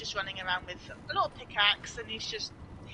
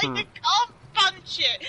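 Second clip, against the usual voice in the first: mock yell; 10 semitones higher, 9 dB louder, slower than usual. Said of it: "I'm like".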